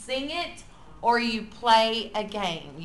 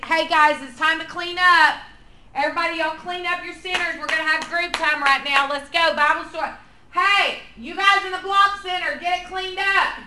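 loud, higher classroom call, building; getting louder; 6 semitones higher, 8 dB louder than usual. Shown as a demonstration. Teacher shouting clean-up orders, growing louder. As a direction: call over the whole room, louder each line. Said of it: "your voice gets louder and louder".

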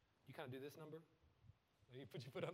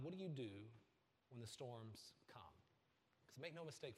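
ordinary talk, nothing marked

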